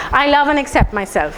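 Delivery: ordinary talk, nothing marked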